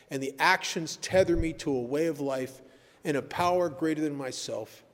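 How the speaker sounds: ordinary talk, nothing marked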